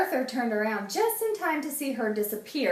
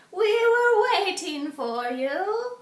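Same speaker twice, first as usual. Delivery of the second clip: put-on high, loud, slow old-woman voice; witch voice; 11 semitones higher, 5 dB louder, slower than usual. A narrator's reading. Old witch greets Arthur at the door, high and slow. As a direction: draw it out like a welcoming old witch.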